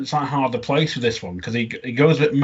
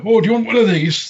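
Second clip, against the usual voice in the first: higher, louder put-on character voice; imitating a pitched-up character; acted character line; 10 semitones higher, 6 dB louder than usual. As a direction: call out the offer in character. Acting the character's first line.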